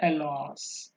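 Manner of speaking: "alas" low and soft, falling. Shown as a demonstration. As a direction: let "alas" sink downwards. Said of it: "fall-, falling tone".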